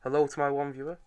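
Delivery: usual speaking voice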